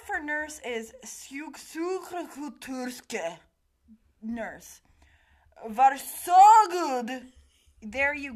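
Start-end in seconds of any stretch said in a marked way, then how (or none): 1.0-3.4 sjuksköterska sounded out slowly in Swedish; Swedish pronunciation. Shown as a demonstration. Co-host showing the word for nurse, sjuksköterska in slow, careful Swedish. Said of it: "how to pronounce them". 5.7-7.2 Varsågod drawn out very slowly and loud; Swedish pronunciation. Shown as a demonstration. Co-host demonstrating difficult Swedish words, Varsågod loud and drawn out. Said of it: "how to pronounce them".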